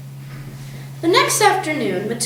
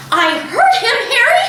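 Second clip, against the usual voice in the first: high, loud frightened shriek; 11 semitones higher, 4 dB louder than usual. A narrator's reading. Mother shrieking to husband that she heard him. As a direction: insist in terror. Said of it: "shrieked the mother".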